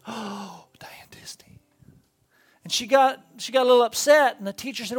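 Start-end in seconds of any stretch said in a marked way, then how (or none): 0.1-1.6 breathless hushed whisper of awe; awed whisper. Retold or imitated. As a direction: whisper it like a starstruck classmate. Classmates awed by the name.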